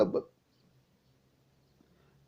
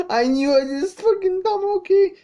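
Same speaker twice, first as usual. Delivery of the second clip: high, crying exclamation; real tearful outburst; 10 semitones higher than usual. Real, not acted. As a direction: voice leaps up in tearful vindication. Overcome by emotion at recognising Tama.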